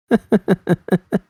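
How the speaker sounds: high bursting laugh that won't stop; full-blown laughter. A narrator's reading. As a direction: break into helpless laughter. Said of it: "broken out into full-blown laughter".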